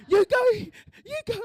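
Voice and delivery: high-pitched, jittery urging; nervous urging. Retold or imitated. Daughter sending him in before her, shivering with nerves. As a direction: push him ahead of you in a high, panicky voice. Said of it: "I was just shivering".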